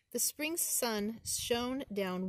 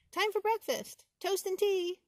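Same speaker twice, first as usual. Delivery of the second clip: much higher, louder calls; peeper shouting; 11 semitones higher, 8 dB louder than usual. Acted. The spring peeper calling out at the top of his lungs.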